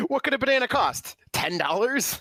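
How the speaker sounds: high-pitched, incredulous guess at a price; put-on high voice, naively asking; clueless quoted character. Quoting a known comedy line.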